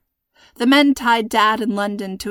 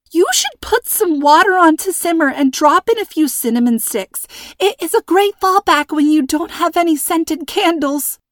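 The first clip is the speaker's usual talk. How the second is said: high, loud hysterical squeak; 8 semitones higher, 5 dB louder than usual. A narrator's reading. Terrified hostage chattering to kidnappers, high squeak. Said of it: "Her voice went from breathy to high squeak".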